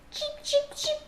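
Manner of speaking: very high tiny peeping voice; baby chick cheeps. Acted. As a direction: chirp like newborn chicks. Baby chicks just hatched.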